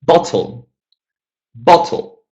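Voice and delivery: "Bottle" punched on BOT; stress on first syllable. Shown as a demonstration. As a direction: hit BOT, let the end of "bottle" fall. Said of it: "let's stress the first".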